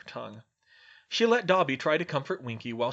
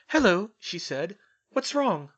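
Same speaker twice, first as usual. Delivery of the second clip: put-on lightly higher girl's voice; a hint of brisk schoolgirl; young witch; 6 semitones higher, 6 dB louder than usual. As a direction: Hermione inviting the elf, lightly voiced girl. Hermione greets the elf.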